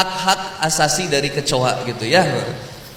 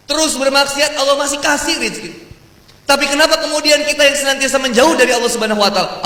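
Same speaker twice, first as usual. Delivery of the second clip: fiery preaching; 7 semitones higher than usual. Acted. Preacher pressing the congregation about God's endless gifts. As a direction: build the sermon to full force.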